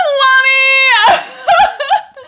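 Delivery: high loud burst of laughter; shrieking laugh. Real, not acted. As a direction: laugh with delight. After greeting viewer Swami.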